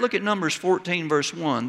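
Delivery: ordinary talk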